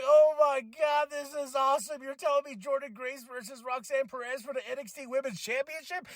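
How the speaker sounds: high, thrilled rush of disbelief; excited. Retold or imitated. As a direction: gush like a fan. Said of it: "My mind was like".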